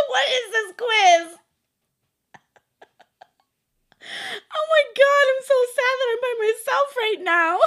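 high, loud laughter breaking the words; real loud laughing; laughing shout. Real, not acted. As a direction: shriek with laughter at the answer. Reads absurd answer, bursts laughing.

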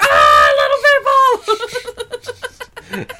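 startled shout, sudden blast. Acted. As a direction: yell it out in alarm. Acted shout at strange sight.

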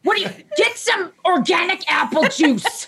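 high, slow, fussing voice of an anxious mom; mock frantic parent. Therapist mocking herself hovering in a nursery, high and fussy. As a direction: play the overbearing onlooker barking tips from the corner. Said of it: "I would be in the corner going like".

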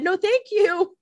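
high, polite mock refusal; a prissy high no thanks; prim refusal. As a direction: [name] declining Esther, mock polite and high. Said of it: "I said".

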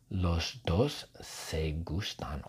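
slow, low, hushed narration; sultry half-whisper. A narrator's reading. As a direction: breathe it slowly as romantic gossip. Narrates the characters' mutual attraction. (susurro lento y sensual)

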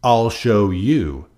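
loud, slow grouchy threat. A narrator's reading. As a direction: spit it out slowly and loud. Grouchy ladybug storms off angry.

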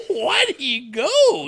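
high, loud disbelieving squeak; can't believe anyone would choose that pain; incredulous. Retold or imitated. Quoting his reply to his wife, incredulous high squeak. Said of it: "And I'm like".

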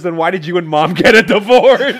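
loud question bursting into laughter; laughing, loud mock-innocent question; cracking up. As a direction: blurt it loudly, barely holding the laugh. Son's blunt question, cracking up.

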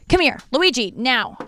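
loud, high-pitched command to a dog; calling the dog. Real, not acted. Owner calling her dog away from passing children, loud command.

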